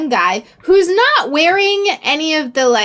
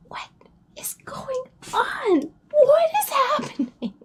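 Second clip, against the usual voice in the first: much higher whisper breaking into a laugh; baffled whisper; 12 semitones higher, 6 dB quieter, slower than usual. Retold or imitated. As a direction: whisper in disbelief, half laughing. Baffled aside while stranger approaches.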